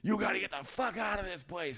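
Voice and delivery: high, strained mock yell at reduced volume; squeezed, high-pitched pretend scream; retold screaming. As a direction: imitate a furious roommate screaming, held back. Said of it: "he starts yelling and screaming".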